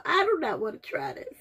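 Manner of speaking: laugh-whine with "do" pushed up; whining through a laugh about tasting it. Real, not acted. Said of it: "I'm about to start crying".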